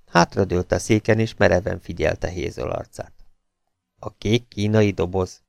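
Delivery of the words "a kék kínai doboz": ordinary delivery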